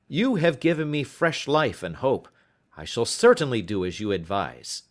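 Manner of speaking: put-on worried young client explaining; young visitor. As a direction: voice the anxious visitor. Openshaw's dialogue voice.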